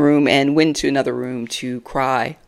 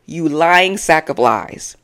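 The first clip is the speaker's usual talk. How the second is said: ordinary delivery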